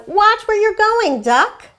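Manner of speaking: high, loud warning from a fussy chicken; cross chicken. Acted. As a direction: snap a warning like an irritated hen. Chicken's thought voiced in story.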